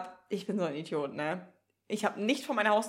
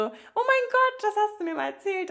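mock shocked friend; 11 semitones higher than usual. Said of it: "meinte nur so".

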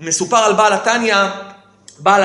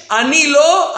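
higher imitation of a cry of denial; retold cry; 5 semitones higher, much slower than usual. Teacher quoting pupil's protest back, retold cry. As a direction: raise the pitch, quoting a protest. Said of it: "וגם צעקת כעונש".

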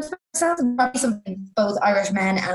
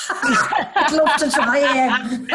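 laughing; 3 semitones higher, much slower than usual. Real, not acted. Laughing while repeating joke.

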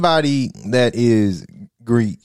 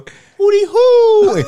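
slow, much higher hammed-up voice; mock frat call; 6 dB louder, much slower than usual. Friend joking that Omegas show up with a hootie hoo. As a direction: announce the call like it's coming.

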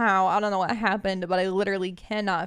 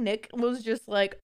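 ordinary talk

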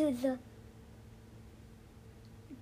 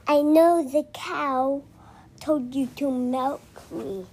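goat voice. Acted. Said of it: "The goat says".

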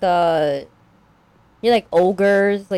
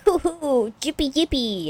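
high, soft sing-song cheer; half-hearted pretend whooping in a high voice; mock glee; 6 semitones higher, 4 dB quieter than usual. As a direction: tootle it like a naively happy family. Mocking family's glee at free admission.

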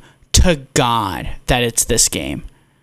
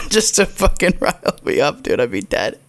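laughing while talking; 2 semitones higher than usual. Real, not acted. Cracking up at co-host riling him up, laughing. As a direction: talk through the giggles. Laughing at his own scenario.

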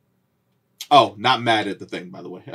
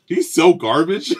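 teasing; 6 semitones higher, slower than usual. Acted. Teasing friend's favorite hero.

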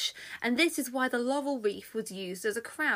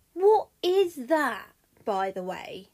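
curious Argus; 6 dB louder, slower than usual. Acted. Argus asks Mercury about the pipes, loudly curious. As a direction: ask slowly with big curiosity.

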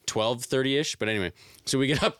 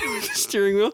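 usual speaking voice

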